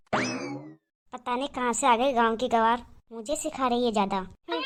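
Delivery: slow, low scornful muttering to herself; sister-in-law grumbling about the village girl, low and scornful; contemptuous grumble. Acted. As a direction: sneer under her breath, dragging the words. Elder daughter-in-law grumbles in contempt.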